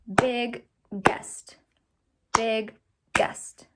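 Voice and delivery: big guest said in two clapped beats, big then guest; split into syllables. Shown as a demonstration. Teacher clapping syllables for students, two beats. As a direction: break biggest into big and guest. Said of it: "it would be two claps".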